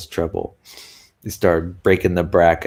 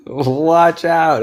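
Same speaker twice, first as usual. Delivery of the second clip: laughing; 9 semitones higher, 5 dB louder than usual. Real, not acted. Joking about weekend eating.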